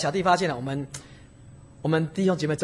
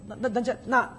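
normal talking voice

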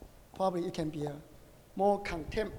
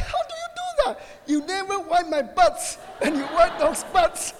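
high, loud indignant complaint with laughter in it; comic jealous-husband outburst; mock angry; 7 semitones higher, 6 dB louder than usual. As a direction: protest to the wife in hammed-up outrage. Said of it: "I was so angry".

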